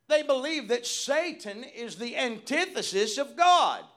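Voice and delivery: high, forceful, declaiming sermon voice; emphatic preaching. Real, not acted. Preacher correcting a belief about Satan, emphatic preaching. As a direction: proclaim it loudly with weight on key words.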